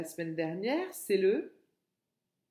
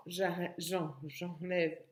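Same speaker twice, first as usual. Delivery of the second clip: ordinary talk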